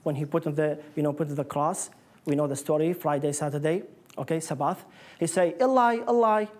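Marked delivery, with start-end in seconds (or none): 5.6-6.5 raised, louder cry of Eli, Eli; lifted, anguished call. Retold or imitated. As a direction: call the name out loud like a cry from the cross. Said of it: "Jesus cried out in a loud voice".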